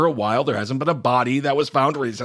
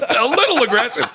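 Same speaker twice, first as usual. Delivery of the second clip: higher, laughing through the words; 10 semitones higher, 5 dB louder than usual. Real, not acted. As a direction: giggle it back. Laughing repeat.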